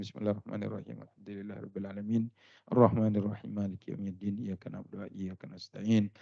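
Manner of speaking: usual speaking voice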